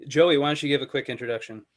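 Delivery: ordinary talk, nothing marked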